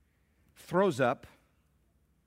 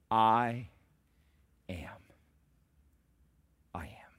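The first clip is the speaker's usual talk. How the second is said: hushed divine answer; 3 semitones lower, slower than usual. Acted. God answering Moses.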